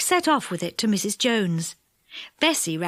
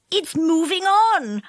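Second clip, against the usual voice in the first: Moonface; 7 semitones higher, 3 dB louder, slower than usual. A narrator's reading.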